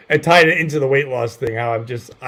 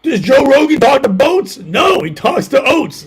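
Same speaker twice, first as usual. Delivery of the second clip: shouting; 8 semitones higher, 5 dB louder, slower than usual. Retold or imitated. Joke shouted in character.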